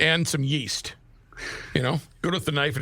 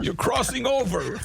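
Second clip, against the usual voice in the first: put-on Borscht Belt Yiddish accent shtick; Jackie Mason voice; 7 semitones higher than usual. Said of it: "Now I sound like".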